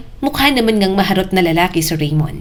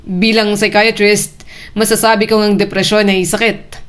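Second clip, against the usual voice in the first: mocking tone. A narrator's reading. Raymond mocks Ariel's faith, lightly mocking. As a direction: tease her with calm, amused certainty. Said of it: "Nakakalokong sabi ni Raymond".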